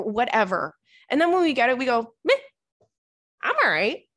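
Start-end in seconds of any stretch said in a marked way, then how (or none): 2.2-4.1 bored meh. Retold or imitated. Acting out losing interest.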